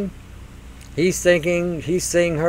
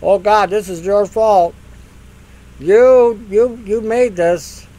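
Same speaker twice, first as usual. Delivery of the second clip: louder, higher accusing wail slowing at the end; mock blaming God, louder and higher; 4 semitones higher, 4 dB louder, slower than usual. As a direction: point the finger at God, whiny. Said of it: "blaming God".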